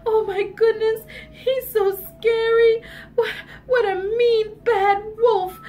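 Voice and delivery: high, loud storybook wailing; hammed-up tearful distress; crying. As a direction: wail like a frightened little girl.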